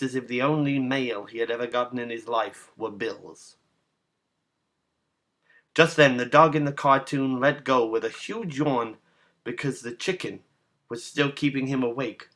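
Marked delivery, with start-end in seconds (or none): none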